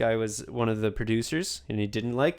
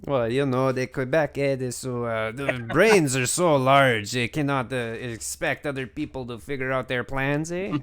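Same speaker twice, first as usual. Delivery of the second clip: a hint of Quebec French, teasing; playful mock Quebecois accent. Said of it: "that's my best Quebecois accent".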